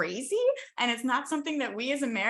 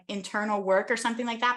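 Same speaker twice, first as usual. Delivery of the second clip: normal talking voice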